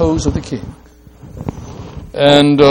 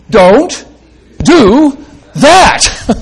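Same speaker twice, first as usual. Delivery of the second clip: sharp raised prohibition; forceful command; 9 semitones higher, 6 dB louder, much slower than usual. Shown as a demonstration. Preacher voicing the angel's stern command. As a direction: say it like the angel forbidding it. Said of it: "Let's say it".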